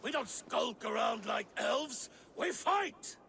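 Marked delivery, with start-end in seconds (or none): 2.4-3.2 rising to a war cry; battle shout. Acted. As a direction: bellow for the fight. Thor demands battle.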